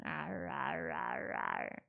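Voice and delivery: low "arr" growls sinking down; repeated growling noises. Retold or imitated. She repeats the growls for her roommate: low mock growling. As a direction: growl it again, unbothered. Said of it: "I start doing it again".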